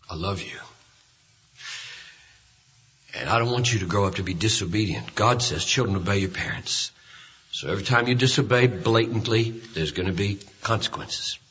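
low, soft and unhurried; tender father. Retold or imitated. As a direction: quietly explain it to a small child. Father talking to child after spanking.

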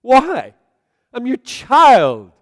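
high, very loud, drawn-out protest; anguished outcry. Acted. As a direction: cry out to God like a wounded child. Acts out protest to God.

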